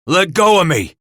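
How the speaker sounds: loud, high cry of fury; angry demand. A narrator's reading. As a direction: shout it while struggling to break free. Cooper struggles in a furious fight.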